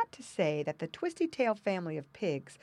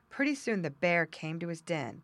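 usual speaking voice

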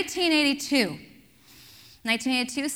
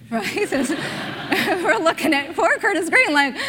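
laughing; 3 semitones higher, slower than usual. Real, not acted. Preacher recalling poor Curtis to congregation, laughing. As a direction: talk through laughter. Laughing after the teacher impression.

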